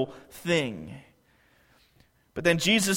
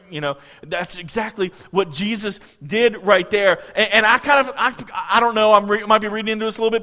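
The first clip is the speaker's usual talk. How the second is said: normal talking voice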